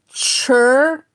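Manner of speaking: clipped slang "chur", one syllable. Shown as a demonstration. Teacher showing the slang version of culture, said slow. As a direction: say "chur" as the lazy slang way, drawn out. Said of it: "but that's just slang".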